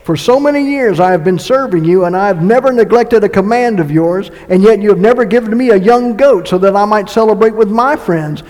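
loud, driving scripture read; fervent preacher reading scripture; preaching at full force. Acted. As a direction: preach it out to the room.

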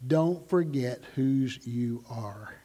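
slow, soft and low, like a mother at the door; tender reminder. Retold or imitated. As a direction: say it gently to your child as they leave. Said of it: "she'd say".

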